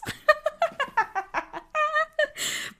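helpless laughter up high; very high-pitched laugh. Real, not acted. Cringing at her old caption, she laughs high. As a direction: let the laugh go high and long.

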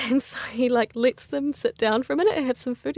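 normal talking voice

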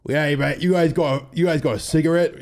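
lightly hammed-up drunk mate asking for a smoke; drunk slurring. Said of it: "slurring his words like".

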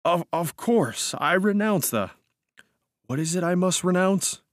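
high, halting, humouring tone; kindly. A narrator's reading. Startled cousin humouring the narrator, kindly. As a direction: placate him gently, faltering as you go. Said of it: "said kindly".

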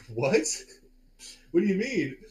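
mock-stunned, asking what he means; retold disbelief. Teller to tripping Richard about his hands, retold disbelief.

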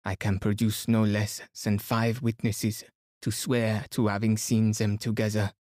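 put-on French-accented detective, declamatory; Poirot. Poirot declaring the suspect's alibi to everyone, formally accented.

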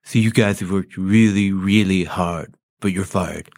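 low Trump with pursed lips. Shown as a demonstration. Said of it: "say that very same thing with your lips pursed".

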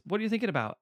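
lightly voiced partner question; mock partner. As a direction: ask it as the girlfriend in bed. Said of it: "the partner turns to the guy and says".

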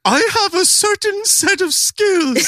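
very high, slow mock tough-guy line; high cracking teen doing a tough line; squeaky teen voice. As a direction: squeak out a movie threat like a teenager. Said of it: "that voice is, of course, [name] at fifteen".

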